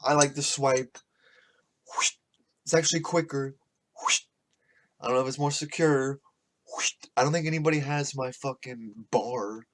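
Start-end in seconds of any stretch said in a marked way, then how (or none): 1.9-2.1 short airy whoosh; swipe sound effect. Retold or imitated. Showing how a card swipes, quick airy whoosh. 4.0-4.2 short airy whoosh; swipe sound effect. Retold or imitated. Showing how a card swipes, quick airy whoosh. 6.7-6.9 short airy whoosh; swipe sound effect. Retold or imitated. Showing how a card swipes, quick airy whoosh.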